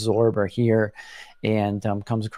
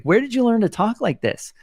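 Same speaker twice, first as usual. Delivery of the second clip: amazed, much higher repeat; 12 semitones higher, 3 dB louder than usual. Retold or imitated. Retelling asking friend Tim how he learned to talk, excited higher repeat. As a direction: pitch jumps way up in excited disbelief.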